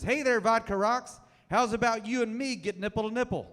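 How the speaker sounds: lightly voiced smarmy come-on; sleazy pickup line. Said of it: "a man walks up to her and says".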